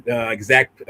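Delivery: ordinary delivery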